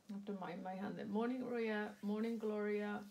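ordinary delivery

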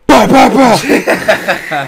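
drawn-out high cackle; a slow, squeaky villain chuckle; high slow mock laugh.

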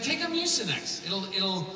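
hammed-up pushy advice giver; insistent retold advice. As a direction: push it like an eager know-it-all. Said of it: "He's like".

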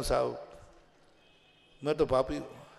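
low, soft, unhurried confession; humble admission. Acted. Devotee answering his challengers, humble. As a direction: admit it meekly with a pause.

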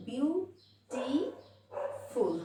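Beautiful very slowly as বিউ, টি, ফুল, softly; soft, drawn-out beautiful with a pause after each syllable; split in three syllables. Shown as a demonstration. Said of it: "দেখো তিনবার উচ্চারণ হয়েছে". (Beautiful আস্তে, তিন ভাগে)

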